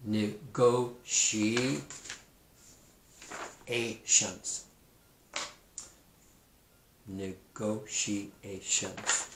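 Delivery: "negotiations" stretched out very slowly, each syllable drawn apart; slowed, syllable by syllable. Shown as a demonstration. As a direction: sound out "negotiations" slowly for kids to hear every part. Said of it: "That word".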